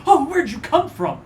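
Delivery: shocked shopper; startled customer. Acted. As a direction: jump back and ask.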